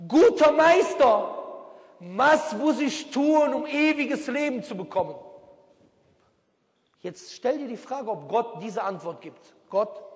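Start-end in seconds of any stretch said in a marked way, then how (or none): 0.1-5.2 high, slow, loud voice of a man asking Jesus; a stranger begs the Master, high and slow; pleading questioner. Retold or imitated. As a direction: plead like a humble seeker, drawn out and high. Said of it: "Es kommt jemand zu ihm, sagt".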